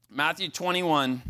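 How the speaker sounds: normal talking voice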